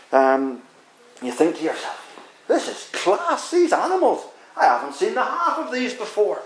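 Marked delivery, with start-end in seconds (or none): 2.5-6.4 amazed onlooker. Retold or imitated. Impressed onlooker admiring the animals.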